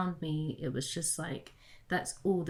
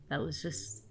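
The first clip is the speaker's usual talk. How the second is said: normal talking voice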